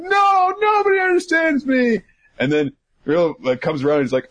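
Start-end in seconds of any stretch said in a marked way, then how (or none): 0.0-2.0 mock tantrum wail. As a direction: whine it out dramatically. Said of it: "as he's going".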